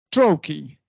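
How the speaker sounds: ordinary talk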